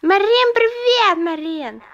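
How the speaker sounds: loud, high calling greeting; excited coaxing. Real, not acted. Parent prompting a small child to greet Marin, loud and coaxing. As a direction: call it out brightly high, dropping at the end.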